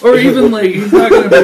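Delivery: high, loud laughing words; cracking up mid-sentence. Real, not acted.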